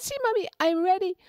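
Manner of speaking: put-on high, eager child voice; little rabbit voice. A narrator's reading. Little rabbit telling his mother he is ready, high and eager. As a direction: bounce it up like an excited little bunny. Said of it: "He said".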